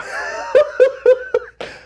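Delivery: high rising dotty giggle; Hatter giggle. Acted. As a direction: squeal of a mad laugh. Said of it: "Mad Hatter voice".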